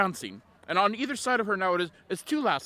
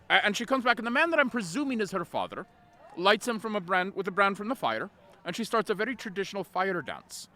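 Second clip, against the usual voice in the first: usual speaking voice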